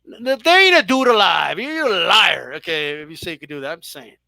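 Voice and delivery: normal talking voice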